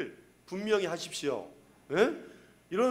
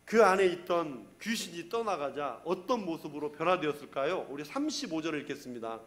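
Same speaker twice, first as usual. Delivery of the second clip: ordinary talk, nothing marked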